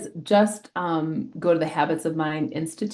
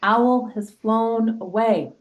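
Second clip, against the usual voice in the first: higher and slow, ominous reveal; dramatic warning; 6 semitones higher, 3 dB louder, slower than usual. Retold or imitated. Teacher warning students about drugs, dramatic slow reveal. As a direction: drop it on them like bad news. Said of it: "I'm able to tell the students".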